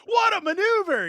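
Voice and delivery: squeaky mock play-by-play line; hammed-up announcer. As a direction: ham up the catchphrase. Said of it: "I'll use that line".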